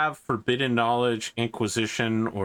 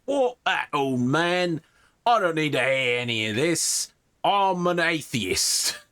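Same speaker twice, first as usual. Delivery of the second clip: insolent guard, higher, slow on the last words; 6 semitones higher, slower than usual. Acted. Guard mocking the old priest, higher insolent voice. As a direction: sneer it like a rude young soldier.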